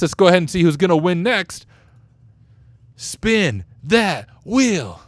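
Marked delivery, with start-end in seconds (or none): 2.9-5.0 slow, drawn-out announcer call; game show host. Pretend. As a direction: stretch it like a TV host. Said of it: "I should be a game show host".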